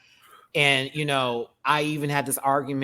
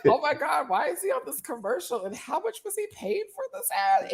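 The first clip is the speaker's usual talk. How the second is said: high-pitched, softer acted bewilderment; mock disbelief; 11 semitones higher, 5 dB quieter than usual. Recalling herself watching his college commercial, mock disbelief. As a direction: replay baffled viewer reaction, pitched up. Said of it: "I used to be like".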